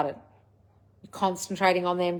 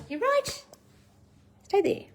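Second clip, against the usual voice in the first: much higher breathy pet voice, softer; talking to the dog; 12 semitones higher, 4 dB quieter, slower than usual. Real, not acted. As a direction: coo at the dog getting in the wires.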